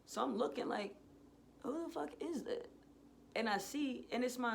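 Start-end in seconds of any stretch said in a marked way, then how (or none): 1.6-2.7 high-pitched bewildered question; taken aback. Retold or imitated. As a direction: wonder in disbelief who that is. Startled reaction to approaching figure.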